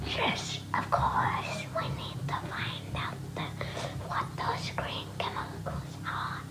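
hushed, voiceless whisper. Acted. Elliot whispering back to Kai in the dark lab. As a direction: whisper it so the guards can't hear. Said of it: "Elliot whispered back".

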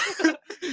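real burst of high laughter. Real, not acted. Laughing at the friend's joke about the girls, real laugh. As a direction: crack up at the joke.

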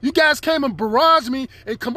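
normal talking voice

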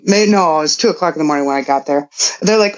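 usual speaking voice

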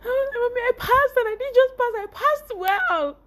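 high, loud, crying with joy; tearful joy. Retold or imitated. Hugging her mother, high tearful joy. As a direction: sob it out happily. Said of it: "we were crying".

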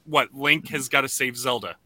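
usual speaking voice

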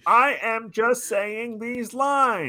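slow, high, wooden line reading; bad acting. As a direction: recite like a terrible actor. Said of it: "he's doing, like".

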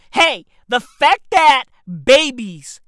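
usual speaking voice

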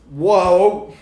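loud, high awed whoa; a big amazed whoa. Retold or imitated. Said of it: "it'll just be like".

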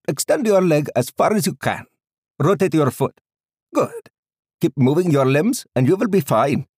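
put-on high, loud Indian-accented doctor; thick Indian accent. A narrator's reading. Doctor instructing and reassuring his patient, high loud Indian accent. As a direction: give exercise orders in a high Indian accent. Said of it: "he said in a thick Indian accent".

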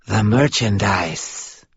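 slow, soft murmur with an odd foreign lilt; soft sibilant voice. A narrator's reading. Unseen caller on the phone, slow sibilant murmur. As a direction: purr it slowly like an unsettling stranger. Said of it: "murmured a soft, sibilant voice with an odd accent".

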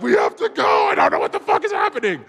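high, frantic urging, acted out; hammed-up panic in a retelling; panicked mock yell. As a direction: yell it high as if fleeing in confusion. Acts out panic while dragging friend.

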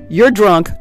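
ordinary talk, nothing marked

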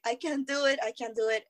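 ordinary delivery

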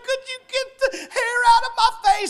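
high, whiny mock sobbing plea; crying. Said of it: "I can hear her crying".

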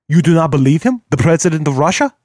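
a doubting Bulgarian diplomat; Bulgarian accent. A narrator's reading. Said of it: "His Bulgarian accent".

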